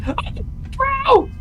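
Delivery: very high shocked yelp; high mock shriek of surprise; startled gasp. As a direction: squeal it in mock alarm. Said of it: "looks around and goes".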